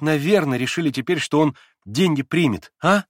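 put-on eager young woman pressing her point; young Lise. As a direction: play the agitated young girl Lise. Lise's voice in audiobook.

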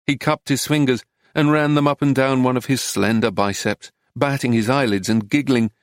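plain reading voice

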